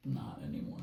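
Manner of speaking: low whispered punchline; hushed, under the breath. Real, not acted. Whispered joke after jelly beans.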